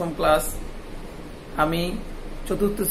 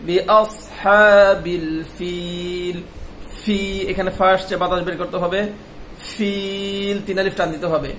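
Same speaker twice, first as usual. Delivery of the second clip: usual speaking voice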